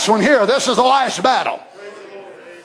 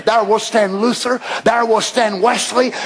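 normal talking voice

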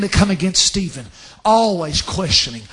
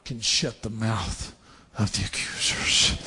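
hushed preaching; 11 semitones lower, 9 dB quieter than usual. Real, not acted. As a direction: drop to a hush for the payoff. Hushed payoff after preacher's build.

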